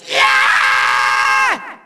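long drawn-out villain yell; hammed-up howl of defeat; frustrated yell. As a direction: let out a sustained cry of outrage. Villain yells as he loses.

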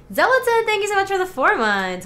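high, bright shout-out; excited thanks. Real, not acted. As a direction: greet them with big energy.

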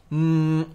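"んー" as a pure M, no vowel; isolated M consonant. Shown as a demonstration. Teacher isolates the consonant of み, sustained M hum. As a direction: hold a long hummed "んー" as mmm. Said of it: "この音ですね".

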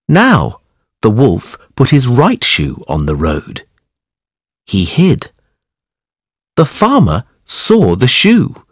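plain reading voice

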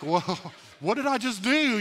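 laughing, taken aback by your own outburst; startled at himself. Acted. Preacher reliving his car-window outburst, startled.

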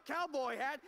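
ordinary delivery

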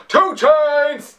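high, loud rapper ad-lib. Retold or imitated. Vlogger copying the rapper's ad-lib, high and loud. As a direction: bark his name like the rapper's hype tag. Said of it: "I sounded just like him".

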